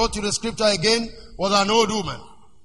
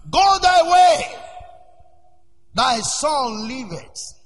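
slow, booming high command of Jesus; commanding shout; 4 semitones higher, much slower than usual. Acted. Preacher voicing Jesus to the nobleman, slow booming command. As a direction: ring it out slowly like a divine command.

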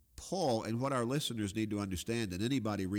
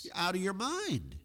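mock outburst; 8 semitones higher than usual. Paraphrasing Festus's outburst at Paul.

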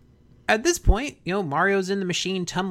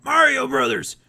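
higher barked drill command; drill sergeant; 5 semitones higher, 12 dB louder, slower than usual. Retold or imitated. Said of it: "we hear".

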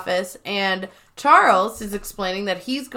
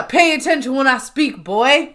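loud, higher scolding; mock yell; 4 semitones higher, 4 dB louder than usual. Charles yelling at Jim, mock-stern scolding. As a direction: scold like an overbearing boss. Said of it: "Charles yells at Jim".

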